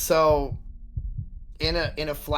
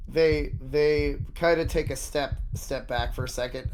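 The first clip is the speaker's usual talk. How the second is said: ordinary talk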